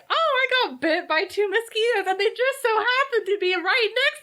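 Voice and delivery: high, sing-out mock innocence; oblivious naif. Jonathan explaining away his bite marks, high and oblivious.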